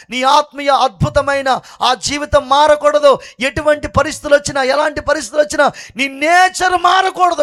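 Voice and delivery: loud, driving preacher's cry; fiery preaching. Acted. Preacher urging believers never to change, fiery preaching. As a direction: exhort the crowd with full force.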